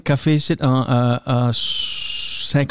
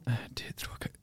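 very soft, under-the-breath count; whispered counting; 3 semitones lower, 19 dB quieter than usual. Shown as a demonstration. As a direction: count to yourself, barely audible. Demonstrates counting to himself. (compter tout bas en chuchotant)

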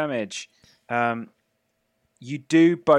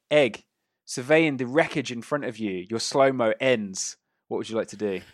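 ordinary delivery

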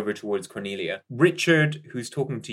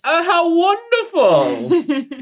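put-on higher, louder, slow and thrilled old gent; a lonely old man thrilled to meet an Englishman; old man delighted to meet an English lady; 9 dB louder, slower than usual.

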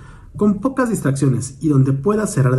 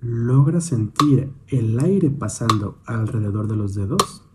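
calm, measured meditation guidance with long pauses; guided meditation. Real, not acted. Instructor guiding a listener's attention to the hands, meditative. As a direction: guide gently, leaving space after each prompt.

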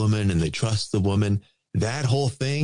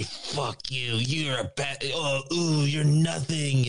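high, mocking sneer at him; imitating her sneer; 3 semitones higher, slower than usual. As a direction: spit it out like a scornful diva. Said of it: "she's gonna get in his face and say".

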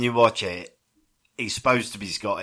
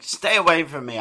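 louder, higher mock outburst; raised-voice imitation of a film quarrel; aggressive shouting; 7 semitones higher, 6 dB louder than usual. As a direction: act out an aggressive rejection. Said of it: "so aggressively shouting at each other".

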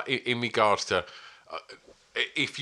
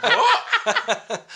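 very high, loud squawk of disbelief; mock astonishment; 9 dB louder than usual. Retelling his shock at the band's name, astonished squawk. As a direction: shriek it, laughing, as a retold shock.

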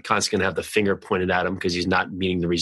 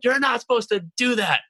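high-pitched retold yell; exasperated, much higher mock holler; mock scream; 13 semitones higher than usual. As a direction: act out screaming at a driver, without full volume. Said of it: "I'm screaming at him".

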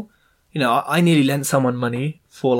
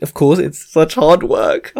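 sarcastic sympathy; 6 dB louder, slower than usual. Real, not acted. Mocking solicitor's easy paid task.

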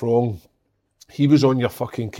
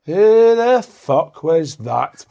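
loud, drawn-out, higher-pitched outburst; big Scottish guy; 5 semitones higher, 6 dB louder, much slower than usual. Retold or imitated. Youth coach turning round after a ball hit him, loud drawn-out outrage. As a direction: stretch it out like an outraged big Scot. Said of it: "Big Scottish guy, like".

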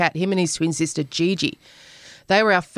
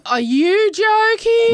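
incredulous; 5 dB louder, much slower than usual. Real, not acted. Exasperated reply.

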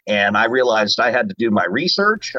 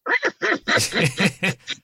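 high squawky duck laugh; Donald Duck laugh; 7 semitones higher than usual. Shown as a demonstration. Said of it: "what does it sound like when Donald laughs".

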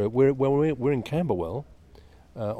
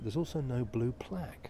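whispering; 3 semitones higher, 4 dB quieter than usual. Real, not acted. Aside about the missing plaque.

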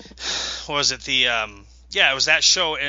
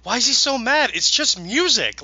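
higher-pitched, imitating her bewilderment; bewildered; 8 semitones higher than usual. Retelling how the DJ reacted to his anger, bewildered. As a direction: act baffled, slowing at the end. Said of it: "she was so bewildered".

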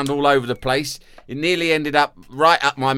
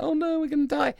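very high squeak of fear; hammed-up child panic; high scared child voice; mock terrified kids; faster than usual.

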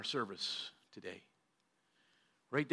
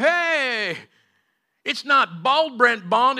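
teasing shout; 11 semitones higher, 13 dB louder, much slower than usual. Retold or imitated. Team teasing Brent after the service.

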